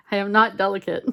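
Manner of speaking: loud, self-mocking laugh in the words; amused admission, laughing out loud. Real, not acted. Laughs at own clumsiness.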